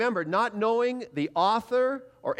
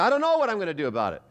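flustered reply; 4 semitones higher, much faster than usual. Retold or imitated. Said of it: "And I said".

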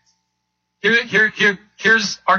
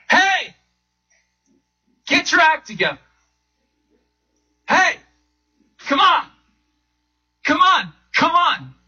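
impatient summons; 8 semitones higher than usual. Retold or imitated. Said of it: "He doesn't stand up in heaven apart from us and say".

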